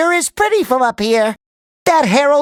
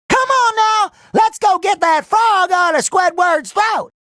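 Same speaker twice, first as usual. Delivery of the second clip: high, hollered folksy voice; high-pitched rallying holler; hollering old-timer; 8 semitones higher than usual. Acted.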